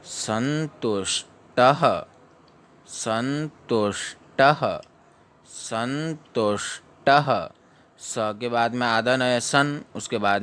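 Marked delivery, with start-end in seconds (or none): 0.0-7.5 teacher models संतुष्टः slowly; slow recitation. Shown as a demonstration. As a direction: drill संतुष्टः slowly three times. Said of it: "चौदह श्लोक को पढ़ेंगे".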